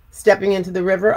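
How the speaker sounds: ordinary delivery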